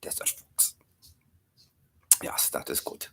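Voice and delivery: breathy whisper, almost no voice. Acted. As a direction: whisper like a secret. Whispered character bits.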